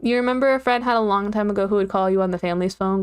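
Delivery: normal talking voice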